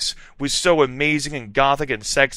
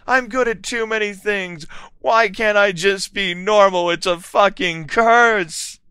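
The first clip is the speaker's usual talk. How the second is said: high, louder, slowing at the end; despairing mock shout; 9 semitones higher, 4 dB louder than usual. A narrator's reading. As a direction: shout it as a curse. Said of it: "I shouted".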